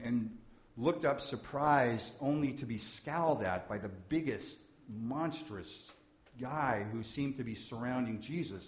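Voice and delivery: ordinary talk, nothing marked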